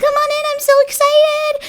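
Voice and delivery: very high-pitched, gushing welcome; thrilled tattoo lady. Retold or imitated. Excited tattoo lady greets her new client, thrilled and high. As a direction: gush like a thrilled hostess, way up high. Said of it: "She's so thrilled".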